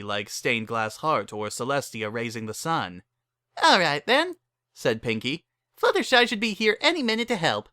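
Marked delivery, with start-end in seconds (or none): 3.6-4.4 much higher, bouncy and cheerful; giddy high pony voice; bubbly Pinky voice. A narrator's reading. Pinky speaks again. 5.8-7.7 much higher, bouncy and cheerful; giddy high pony voice; bubbly Pinky voice. A narrator's reading. Pinky speaks again.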